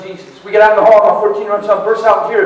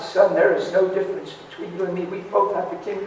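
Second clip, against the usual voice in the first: quieter, settled answer; calm fatherly reassurance; 5 dB quieter than usual. Retold or imitated. Father answers his wailing son, calm and reassuring. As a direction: reason gently with an upset child.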